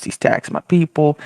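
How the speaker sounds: normal talking voice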